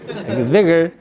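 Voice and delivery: laughing through the line. Retold or imitated. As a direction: chuckle while repeating it. Repeats quote, laughing.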